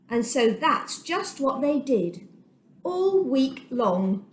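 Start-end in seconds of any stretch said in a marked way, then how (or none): none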